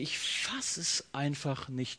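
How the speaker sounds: quiet, drawn-out disbelief; oszołomione niedowierzanie. Acted. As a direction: let the thought sink in slowly and softly. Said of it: "du denkst dir".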